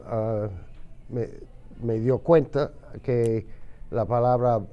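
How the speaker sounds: normal talking voice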